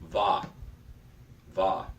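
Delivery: "Va" as one short, separate syllable; first syllable alone. Shown as a demonstration. Teacher isolating the first syllable, demonstrating "Va" clearly. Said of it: "I pronounce the first syllable".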